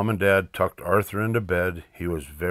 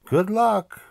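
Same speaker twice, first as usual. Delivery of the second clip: much higher sing-out farewell; teasing send-off; 13 semitones higher, 4 dB louder than usual. Acted. Francine to the boys as the bus comes, teasing. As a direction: call it out high to a departing friend.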